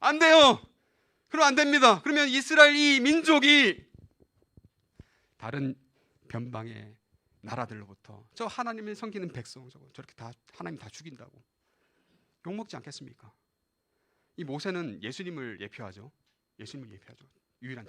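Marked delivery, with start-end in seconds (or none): 0.0-3.9 high, loud, urgent pleading; Moses. Acted. Mediator begging God to spare Israel, loud urgent plea. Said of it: "중재자가 나타나죠. 모세가 나와서". 5.4-13.3 low, soft, halting appeal; Moses. Acted. Mediator reasoning with God over the nations, quiet low appeal. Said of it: "모세가 나와서".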